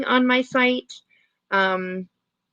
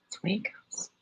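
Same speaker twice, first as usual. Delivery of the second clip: whispering; 11 dB quieter than usual. Real, not acted. Whispered resigned tag.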